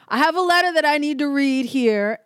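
acted funeral interruption. Stranger interrupting a funeral to read a letter, loudly announcing. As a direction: cut in as a mock public announcer.

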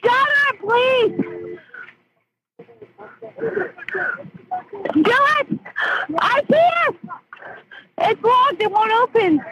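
frantic. Real, not acted. Panic as rescuers break window.

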